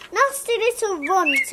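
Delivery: ordinary delivery, nothing marked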